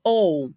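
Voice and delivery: "O" held out as a single vowel; isolated O sound. Shown as a demonstration. Teacher showing what O with W sounds like, held vowel. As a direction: sound out a long, clear "O". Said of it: "O som que teve do O com W foi de".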